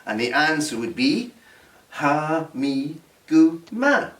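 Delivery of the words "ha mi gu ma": "ha mi gu ma" slowly, each syllable separate, rising at "ma"; teacher spelling out "ha mi gu ma" slowly; slow Gaelic demo. Shown as a demonstration. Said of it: "just one syllable in each word".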